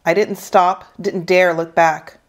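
ordinary delivery

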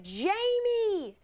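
high, stretched "Jamie" called out over the bar noise; exaggerated shout of her own name. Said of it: "I said".

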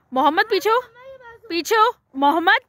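a mother shouting her son back from a cliff edge; calling out. Real, not acted. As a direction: call him back from the drop, sharp and loud.

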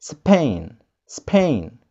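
Spain said SPAYN, one long A; Spain, English diphthong. Shown as a demonstration. Teacher shows the correct English Spain. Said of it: "Repeat after me".

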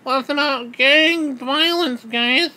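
high, slow, dopey mock politician; high mocking impression of a clueless official; mocking Jeff Sessions. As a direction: mimic him as a clueless official.

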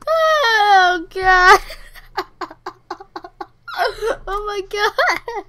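high, loud cracking up; helpless laughter through the words; laughing hard. Real, not acted. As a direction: lose it completely, laughing. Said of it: "that was super funny".

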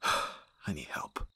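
slow, low, whispered surrender; defeated whisper. Acted. Bodyguard alone, finally giving in: defeated whisper. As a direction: admit it to yourself, spent.

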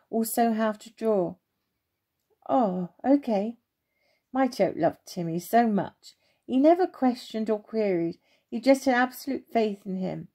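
ordinary delivery, nothing marked

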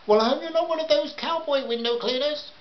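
high, put-on housewife voice; high-pitched wary customer; suspicious housewife. A narrator's reading. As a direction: voice her doubts up high.

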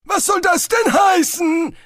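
put-on crotchety old wizard butting in; old wizard cutting in. A narrator's reading. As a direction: blurt it out as a cranky old man. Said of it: "warf Grauhals Spolt ein".

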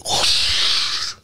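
long voiceless rushing whoosh; imitated crash whoosh. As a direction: blow out a crash noise.

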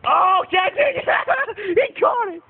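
high, slow, stretched-out cheer with a laugh; joyful high shout; excited cheering. Real, not acted. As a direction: celebrate the catch. Cheering the catch.

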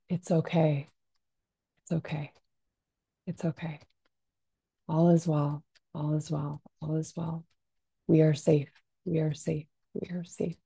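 calm yourself with gentle repeats; soothing repetition. Shown as a demonstration. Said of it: "you can say".

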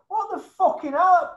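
high, slow, loud incredulous outburst; outraged disbelief. Real, not acted. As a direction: stretch it out in shocked outrage.

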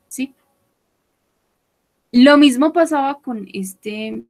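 normal talking voice

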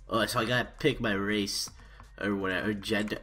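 ordinary talk, nothing marked

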